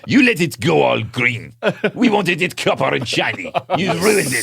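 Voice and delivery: put-on slow, indignant French accent; a French official scolding the Americans. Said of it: "Why do we keep going French?".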